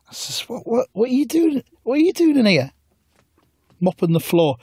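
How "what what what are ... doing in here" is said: high, put-on startled voice; mock alarmed self. Narrator quizzes the mopping man, high and startled. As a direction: squeal the question in surprise.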